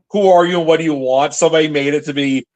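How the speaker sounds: normal talking voice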